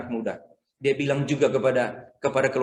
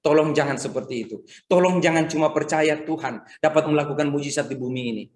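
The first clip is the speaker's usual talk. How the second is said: normal talking voice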